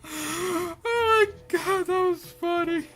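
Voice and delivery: loud high laughter; laughing. Real, not acted. Caught, bursts out laughing.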